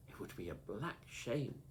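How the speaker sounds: plain reading voice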